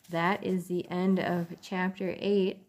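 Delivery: plain reading voice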